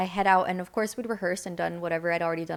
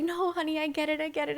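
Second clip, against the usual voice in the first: lightly voiced soothing coordinator; 8 semitones higher, 3 dB quieter than usual. Coordinator soothing excited teen.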